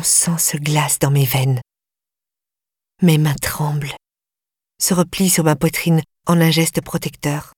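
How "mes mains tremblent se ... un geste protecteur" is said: usual speaking voice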